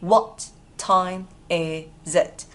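very slow, each word separated; long pauses between words. Shown as a demonstration. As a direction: spell it out slowly for learners. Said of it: "Đọc nối nào".

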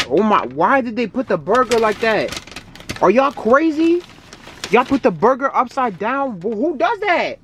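high, incredulous and indignant; can't believe what they did; outraged disbelief. Real, not acted. Outraged at upside-down burger.

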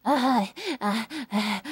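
ordinary talk, nothing marked

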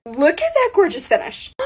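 normal talking voice

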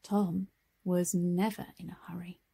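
usual speaking voice

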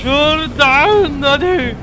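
drawn-out, high, loud call; urgent yell. Real, not acted. Player hunting an exit, urgent slow-stretched shout. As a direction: stretch the words out in alarm. Urging escape during chase.

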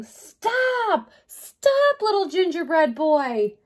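high storybook shout after a runaway. Acted. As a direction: call out "stop" as in a chase.

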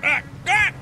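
high sudden yelp of pain. Real, not acted. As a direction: yelp as the leg gets caught. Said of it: "my leg got caught underneath me".

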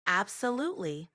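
slow, unhurried delivery; slow the words right down. Shown as a demonstration. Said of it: "Can you speak slower?".

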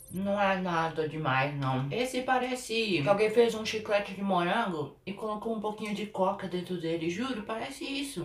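talking between chews; chewing gum while talking. Real, not acted. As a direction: mouth partly full. Seen 1: Face clear; jaw and lip shapes suggest chewing while tasting.

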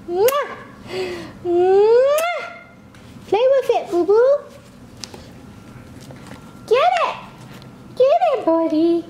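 very high squeaky kisses and cheering. Real, not acted. Mom kissing baby, squealing at him to play. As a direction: cheer him on like he's winning.

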